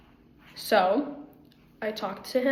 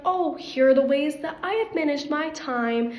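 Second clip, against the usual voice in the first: higher-pitched, enthusiastic demo reply; 5 semitones higher, 3 dB louder than usual. Pretend. Mentor showing a new student time management, enthusiastic. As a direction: lift the pitch to show eager helpfulness. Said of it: "I can be more enthusiastic".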